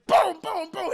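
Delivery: high, loud "boom" hits; punch sounds. Retold or imitated. Narrator imitating Madea hitting the clown, loud high booms. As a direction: punch out each imitated blow.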